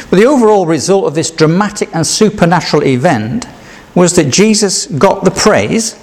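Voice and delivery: ordinary talk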